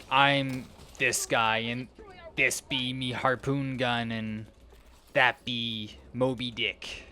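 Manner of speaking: slow, hammed-up pirate talk. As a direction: growl it like an old sea captain. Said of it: "in a pirate voice".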